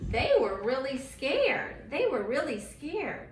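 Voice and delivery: a mom acting out the storm story for children, building drama; dramatic storytelling. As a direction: paint the storm for the kids.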